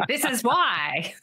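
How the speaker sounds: mock critic. Host imitating critics who judge gentle parents, smug mock. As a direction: drag it out like a know-it-all critic.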